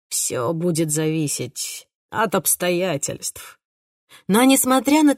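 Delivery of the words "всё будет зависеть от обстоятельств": slow, hesitant answer; reluctant. A narrator's reading. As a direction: say it unwillingly, dragging. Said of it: "ответил с явной неохотой". (неохотно, медленно)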